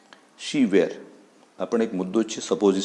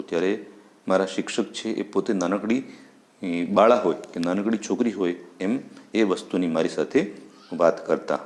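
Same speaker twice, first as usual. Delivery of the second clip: usual speaking voice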